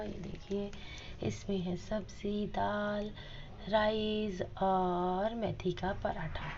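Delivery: soft, slow hush so as not to disturb the deity; hushed near shrine. Real, not acted. To viewers, while Kanha ji eats: real soft whisper. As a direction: drop to a near whisper while showing the offering. Quietly serving food to Kanha ji.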